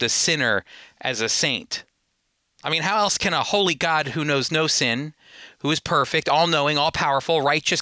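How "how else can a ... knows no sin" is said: ordinary talk, nothing marked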